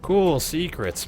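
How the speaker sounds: a high, loud, slow "Cool, secrets"; mock thrilled, drawn out; excited. Real, not acted. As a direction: pitch it way up with delight.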